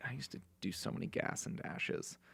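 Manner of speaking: whispered, like a guilty secret; confessional whisper. Real, not acted. Confessing past gas-and-dash thefts to the hosts, whispered. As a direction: whisper it like owning up.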